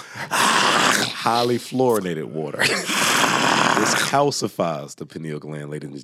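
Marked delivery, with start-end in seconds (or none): 0.1-5.0 slow, higher, through laughter; laughing warning. Real, not acted. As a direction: drag it out while cracking up.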